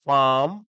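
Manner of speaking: first piece "farm" held long and loud; split into syllables. Shown as a demonstration. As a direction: say "farm" as a separate, stretched chunk. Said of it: "दो टुकड़े।".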